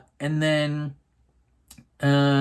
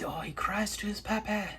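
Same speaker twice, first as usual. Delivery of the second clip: whispered, high and pitying: oh, he cries to his papa; hushed, teasing sympathy; mock pity whisper; 7 semitones higher, 8 dB quieter than usual. Real, not acted. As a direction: whisper it like a sad secret.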